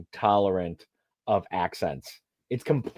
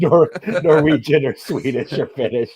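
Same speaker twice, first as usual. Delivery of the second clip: laughing, pitched up and louder; 6 semitones higher, 6 dB louder than usual. Real, not acted. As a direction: laugh the list out.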